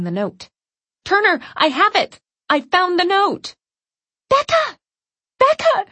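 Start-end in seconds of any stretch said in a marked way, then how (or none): 1.0-3.5 high, loud triumphant shout; urgent shout. Acted. Shouting across police station. 4.3-5.9 high, loud desperate call; panicked calling out. Acted. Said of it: "she calls out after me".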